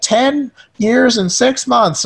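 put-on high, slow child voice; mock little kid. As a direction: announce it slowly like a proud kid. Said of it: "kids are really attached to their age".

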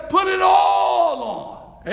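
high, loud, drawn out; preacher's emphatic command; loud, slow command. Acted. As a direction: order them to put it all on. Preaching at full force.

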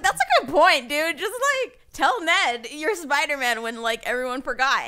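high, loud mock agreement; sarcastic, high and loud, laughing at Peter's plan. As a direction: shout it up high in mock praise. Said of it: "I was like".

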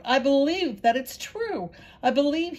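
donkey crying out that he believes; bursting out. A narrator's reading. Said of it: "The donkey burst out".

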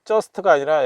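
ordinary talk

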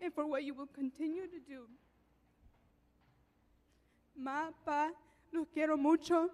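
holding back tears; tearful. Real, not acted. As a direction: voice thick with tears. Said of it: "Okay, I won't cry anymore".

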